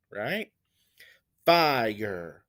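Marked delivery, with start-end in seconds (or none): 1.4-2.4 "Fire" drawn out, FI strong and falling; first syllable stressed. Shown as a demonstration. Teacher showing which syllable is stressed, first syllable stressed. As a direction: lean on the start of "Fire", let the end drop. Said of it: "you can hear the stress syllable is fir".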